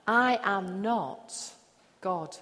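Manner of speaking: usual speaking voice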